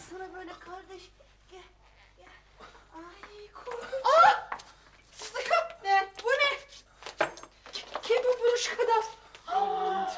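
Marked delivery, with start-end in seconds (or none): 4.1-4.5 sharp high shriek of shock; startled shriek. Acted. Ceset görünce çığlık. 9.5-10.1 shocked gasp. Acted. Buruşuk adamı görünce.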